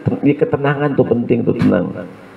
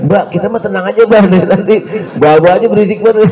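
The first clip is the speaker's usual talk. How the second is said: put-on 1 annoyed child; 10 semitones higher, 5 dB louder than usual. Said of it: "Kata anaknya".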